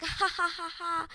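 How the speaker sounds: mock high-pitched ha ha ha; hammed-up polite laughter; fake high laugh. Mock laugh of couple joking.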